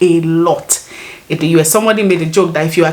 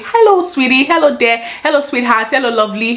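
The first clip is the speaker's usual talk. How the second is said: high sing-song greeting, easing down; mock a stranger greeting with pet names; imitating over-familiar stranger; 5 semitones higher than usual. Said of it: "you can see someone".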